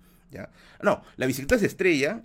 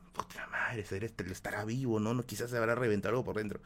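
hushed, breathy worried whisper, quicker at the end; frightened whisper; 3 semitones lower, 6 dB quieter than usual. Retold or imitated. Scared grandfather whispering to himself about the hurt boy, hushed.

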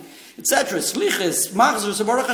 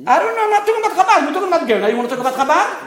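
high, fast, raised-voice rebuttal; heated protest; 9 semitones higher, 3 dB louder, much faster than usual. Real, not acted. Teacher rebuffing a student's question about Chabad, heated protest. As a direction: cut off the challenge, pitched up and rapid. Arguing back at a listener's challenge.

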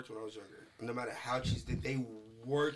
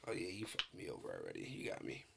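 very low, quiet murmur; voice gone low; 13 semitones lower, 7 dB quieter than usual. Shown as a demonstration. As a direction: drop right down, soft and deep. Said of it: "if I go mad low".

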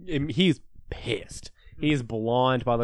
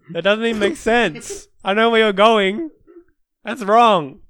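high, loud telling-off; loud protest; 8 semitones higher, 9 dB louder than usual. Real, not acted. Telling a friend off for a mean podcast joke, loud protest. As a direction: shut the joke down loudly.

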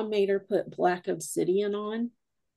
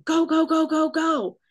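higher, louder frantic "go go go"; urgent panicked calling; 9 semitones higher, 5 dB louder than usual. Retold or imitated. Woman fleeing footsteps behind her, urges others on in fear. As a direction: hurry everyone down the stairs in a panic. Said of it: "you hear her saying".

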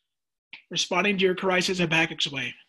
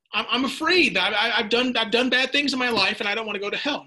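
child's fear, voiced high; 5 semitones higher, 4 dB louder than usual. Son voiced again, afraid.

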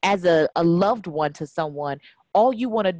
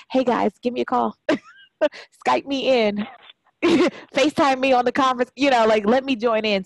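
higher, light, laughing through it; mock cheerful plea; 6 semitones higher than usual. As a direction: play up being the sister stuck on the phone. Mocking herself left out far away.

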